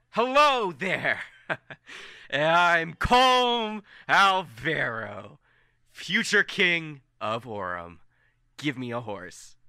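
slow, grandly stretched proclamation; grand hero greeting. Acted. Colm presenting himself to the gate guards, grand proclamation. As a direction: announce yourself like a future king. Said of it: "and he's like".

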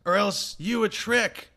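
spooky chain-letter voice. Acted. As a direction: read it like a sassy chain letter. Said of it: "bringing the spooky energy, uh, from that intro".

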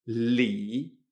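"Li" held low, dipping then lifting; third tone. Shown as a demonstration. Teacher demonstrates L I, "Li" in low third tone. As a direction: dip the pitch down through a drawn-out "Li". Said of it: "L I en tercer tono".

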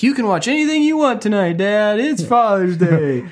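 put-on high, sweet child's voice; kid voice. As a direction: chirp it like an eager kid. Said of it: "They were like".

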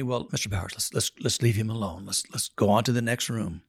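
a hint of a soft, hushed nun; lightly voiced nun murmuring to move on; voicing the nun. Nun voiced in a retold scene.

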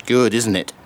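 ordinary talk, nothing marked

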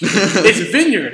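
loud, high, drawn-out VIN-yerd; exasperated correction. Retold or imitated. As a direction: imitate an angry correction, vineyard stretched and loud. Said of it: "my girlfriend gets so pissed".

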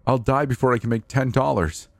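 high, distressed pleading; desperate protest. A narrator's reading. Narrator telling the man it is impossible, desperate protest. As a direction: protest helplessly with the pitch pushed up. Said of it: "I was angry but tried to plead once more".

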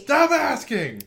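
high, loud, exasperated yell; a high, loud over-the-top snap; exclamation-mark shout. Pretend. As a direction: shout it like five exclamation marks. Mock reply full of exclamation marks.

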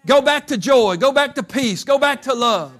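loud, high, rousing sermon voice; preaching at full force. Acted. Preacher urging the congregation to go back, loud and rousing.

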